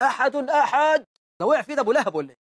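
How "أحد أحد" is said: drawn-out, raised-pitch cry; higher, slow mock wail; mock tormented cry. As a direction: imitate a child crying out under torment. Mimics burned child crying out.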